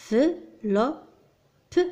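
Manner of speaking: "enveloppe" very slowly in separate pieces; syllable by syllable. Shown as a demonstration. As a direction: sound out "enveloppe" with long gaps between syllables. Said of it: "Mets en ordre les syllabes".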